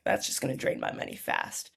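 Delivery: a hushed, breathy aside to herself; whispered worry. Retold or imitated. Worried thought to herself.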